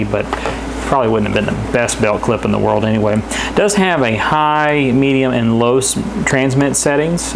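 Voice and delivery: ordinary talk, nothing marked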